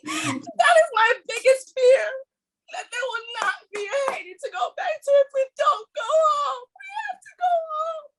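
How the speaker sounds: crying it out, really breaking down; crying shout. Real, not acted. Closing her point in tears.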